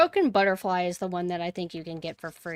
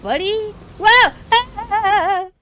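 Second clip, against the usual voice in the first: high, loud startled whoops; excited shouts; 13 semitones higher, 16 dB louder, much slower than usual. Real, not acted. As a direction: yelp with sudden relief. A sudden rescue mid-fight.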